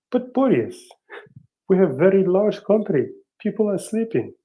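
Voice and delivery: high, loud mock Russian accent. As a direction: play a panicky Russian aide, Russian accent. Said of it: "your, uh, Russian accent, is absolutely awesome".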